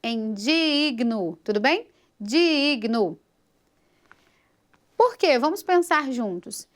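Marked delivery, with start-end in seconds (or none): 0.3-1.4 "digno" with a long, divided DI-I before GNO; split stressed syllable. Shown as a demonstration. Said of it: "vamos dividir a palavra digno". 2.2-3.2 "Digno" as DI-IG-NO, first vowel drawn out; split stressed syllable. Shown as a demonstration. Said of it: "vamos dividir a palavra digno".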